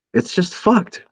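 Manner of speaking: high, loud disbelief; exasperated venting. Real, not acted. Podcast host to cohost, fed up that everything is business.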